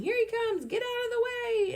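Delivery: high, loud panicked yell; fleeing boys yelling, high and loud. A narrator's reading. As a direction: scream a warning while running.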